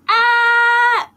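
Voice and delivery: mother screams like a dragon; mother's scream. A narrator's reading. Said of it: "Her mouth opened".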